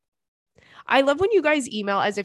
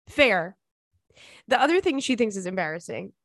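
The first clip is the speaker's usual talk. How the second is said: ordinary delivery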